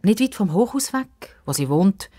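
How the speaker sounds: plain reading voice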